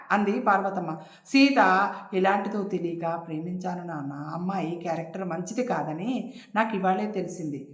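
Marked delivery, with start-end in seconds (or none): none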